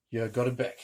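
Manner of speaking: normal talking voice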